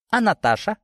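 high, mock-innocent question; feigned innocence, lilting high. A narrator's reading. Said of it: "невинно спросила Мария".

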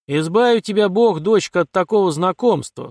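loud, high, appalled warning; wry distaste. A narrator's reading. Father warning his daughter off an acquaintance, disgusted. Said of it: "скривился Вяземский".

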